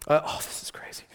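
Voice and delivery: soft breathy whisper, no voice; whispered aside. Real, not acted. Preacher confiding to the congregation, whispered. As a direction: lean in and whisper it to the room.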